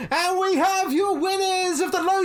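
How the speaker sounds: high, slow, drawn-out winner announcement; announcer call. Acted. Innkeeper calling out the winners to the pub, booming announcer. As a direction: stretch it out like a ring announcer. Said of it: "innkeeper ... calls out and says".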